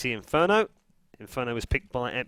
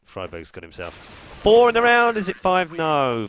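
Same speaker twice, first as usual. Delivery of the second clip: excited call; 2 semitones higher, 8 dB louder, slower than usual. Real, not acted. Live call of a multikill.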